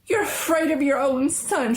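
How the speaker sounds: high, scornful accusation; accusing cry. A narrator's reading. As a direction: throw it at him in anger. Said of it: "she cried".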